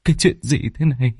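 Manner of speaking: halting, aghast disbelief; horrified stammer. Acted. Man with torch facing a rotting body, horrified stammer. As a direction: stutter and trail out in dread.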